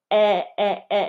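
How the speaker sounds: "eh" as one still vowel, no glide; vowel without movement. Shown as a demonstration. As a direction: hold "eh" steady without moving the jaw. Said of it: "instead of a".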